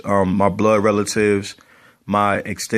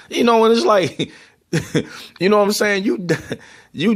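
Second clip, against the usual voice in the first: laughing; 9 semitones higher than usual. Real, not acted. Laughing at the imitation.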